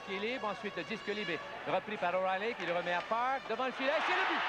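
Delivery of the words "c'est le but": high, loud goal call; excited sports commentary. Real, not acted. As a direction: announce the goal with a burst of excitement. Hockey goal call on play-by-play.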